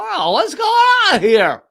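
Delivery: high, puzzled mock concern; mock puzzled pundit. Comedian mocking a TV host's fake puzzlement, high mock bafflement. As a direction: play dumb like a fake-baffled TV host. Mocking Tucker Carlson's furrowed-brow act.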